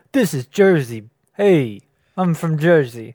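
hammed-up New Jersey accent. Shown as a demonstration. Proving to co-host what Jersey sounds like, mock Jersey accent. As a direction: put on a punchy Jersey accent. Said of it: "you're doing, like, a Jersey accent".

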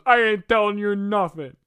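hammed-up defiant henchman; Shocker, defiant. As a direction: refuse loudly like a cartoon crook. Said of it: "Shocker says".

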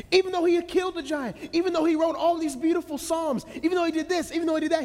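high, fast, insistent run; building preacher list. Acted. Preacher listing what David did for the congregation, high and insistent. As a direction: pile up each "even though".

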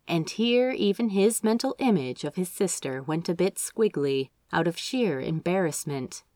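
usual speaking voice

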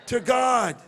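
shouted praise for the crowd; shouted call. Shown as a demonstration. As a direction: call it out loud. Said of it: "say it like you're from Brooklyn".

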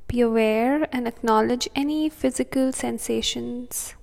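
soothing, unhurried breathing cues; calm meditative tone. Acted. Meditation continues.

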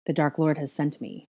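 plain reading voice

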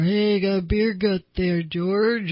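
drawled jeering voice in imitation of a tease; mock teasing. Teasing George about his beer gut, mock jeering. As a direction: needle him in a put-on, stretched-out voice. Example of teasing someone cruelly.

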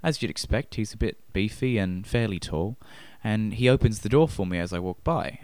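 ordinary delivery

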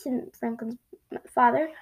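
plain reading voice

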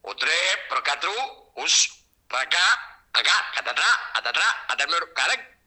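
sing-song mock reversed gibberish; backwards speech. Shown as a demonstration. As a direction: stretch the words musically. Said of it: "Decime una última frase".

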